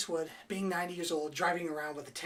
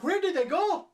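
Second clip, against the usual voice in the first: much higher and louder mock bewilderment; confused cops; 12 semitones higher, 9 dB louder than usual. Cops losing the pair at the cockfight, loud and high bewildered. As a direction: play the baffled cop, overdone. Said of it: "as if the cops are like".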